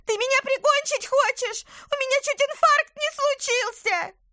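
shrill, loud gasping shriek; gasping for air after a fright. Acted. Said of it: "хватая ртом воздух".

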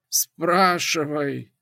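slow, low, rattling oracle voice. A narrator's reading. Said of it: "низким дребезжащим голосом произнесла Пифия".

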